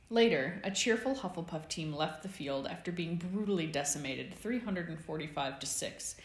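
ordinary delivery, nothing marked

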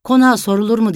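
mother scolding her daughter's city airs; mocking rebuke. A narrator's reading. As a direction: tell her off with scorn. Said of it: "diye çıkıştı".

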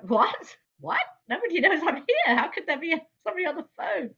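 high, loud, incredulous reaction; astonished, rushing into a high-pitched question; baffled surprise. Retold or imitated. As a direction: blurt it out in total disbelief.

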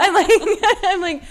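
laughing through the words. Real, not acted. Retelling therapy with a friend, laughing. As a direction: giggle it out.

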